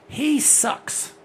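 sudden loud put-down; emphatic outburst. Real, not acted. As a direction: blurt out the verdict. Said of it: "Let me say this again so you don't misunderstand me".

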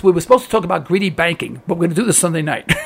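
ordinary delivery

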